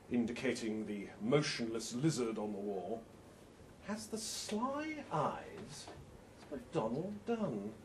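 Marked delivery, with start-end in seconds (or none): 3.9-7.7 sly aside. Acted. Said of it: "I say".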